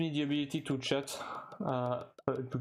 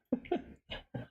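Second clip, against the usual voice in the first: high-pitched rising giggle; high laugh. Real, not acted. Streamer joking about not speaking Spanish, high giggle. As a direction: let the laugh squeak up high.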